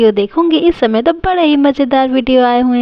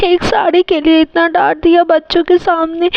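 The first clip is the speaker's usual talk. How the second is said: higher-pitched wounded wail; acted tearful hurt; 6 semitones higher than usual. Wife hurt by husband's scolding, tearful wailing. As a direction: protest as if breaking into tears. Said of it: "mummy कितना ज़्यादा रो रही है".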